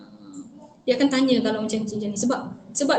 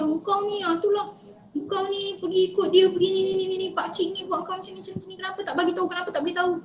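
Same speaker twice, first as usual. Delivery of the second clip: higher-pitched, mildly angry parental nagging; mock scolding; 6 semitones higher, 3 dB quieter than usual. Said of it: "Bukan kita marah dia". (lakonan marah, membebel dengan nada tinggi)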